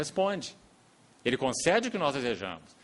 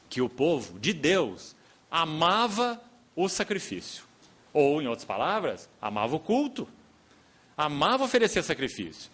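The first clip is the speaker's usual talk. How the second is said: fervent preaching; 4 semitones higher, 5 dB louder than usual. Acted. Preacher rising to full force.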